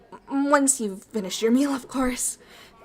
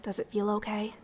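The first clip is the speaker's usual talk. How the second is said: soft whispered check-in; 2 semitones lower, 6 dB quieter than usual. Acted. Alien host asking her human guest, whispered. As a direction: breathe the question gently, no voice.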